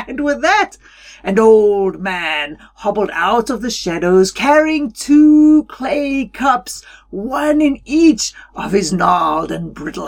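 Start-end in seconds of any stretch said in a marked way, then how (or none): none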